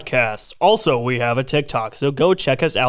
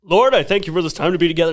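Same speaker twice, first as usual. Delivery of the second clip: rushed prayer; faster than usual. Pretend. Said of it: "No, I'm kidding".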